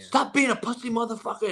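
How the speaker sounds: put-on high barked curse like an old-school coach; mock coach yell. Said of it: "And, like".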